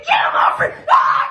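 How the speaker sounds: shrill cries of get him off me; panicked screaming. Acted. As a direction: scream like something's biting you. Screaming for help, attacked.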